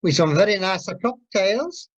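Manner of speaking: ordinary delivery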